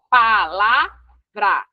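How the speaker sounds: PALAVRA drawn out slowly as pa-la-vra; syllable by syllable. Shown as a demonstration. Teacher writing out the word for students, palavra slowly syllabified. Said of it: "eu vou botar a palavra aqui".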